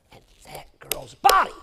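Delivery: hushed whisper. Real, not acted. Preacher whispering to the congregation the girl's fate. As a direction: breathe the words in a whisper.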